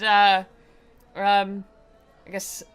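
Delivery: usual speaking voice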